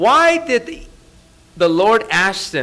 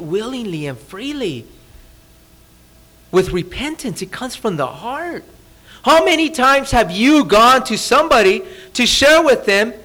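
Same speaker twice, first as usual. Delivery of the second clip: ordinary talk, nothing marked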